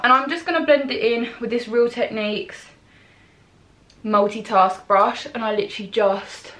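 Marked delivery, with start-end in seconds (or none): none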